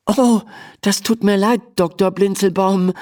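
put-on high, apologetic girl's voice; apologise, a touch flustered; flustered stammer. A narrator's reading. Emma apologising to the offended tiny doctor, flustered. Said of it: "stammelt Emma".

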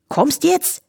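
high, impatient call; calling flea. A narrator's reading. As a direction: call her over in a Viennese lilt. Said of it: "rief der Floh von ihrem Tisch".

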